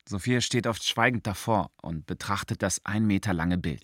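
usual speaking voice

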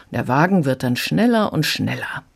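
ordinary talk, nothing marked

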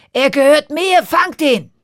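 loud, high royal command; commanding shout. Acted. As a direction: bark the order like a greedy king. King orders bird seized.

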